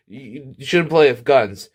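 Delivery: normal talking voice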